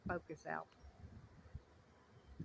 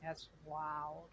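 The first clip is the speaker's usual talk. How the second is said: much softer, lower, in quiet wonder; softly amazed; 5 semitones lower, 8 dB quieter than usual. Real, not acted. Beekeeper watching his first swarm, softly amazed. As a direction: murmur it to yourself, awed.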